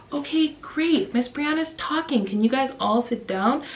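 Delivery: a hint of preschool-teacher voice; teacher to class. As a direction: gently get small children to sit. Teacher addressing young class.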